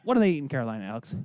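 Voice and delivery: ordinary talk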